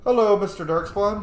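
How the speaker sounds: mock greeting. Real, not acted. Taunting enemy in game.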